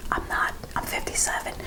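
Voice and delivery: hushed, whispered aside; whispered confession. Real, not acted. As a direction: drop to a whisper, owning up to a fib. Joking aside admitting real age.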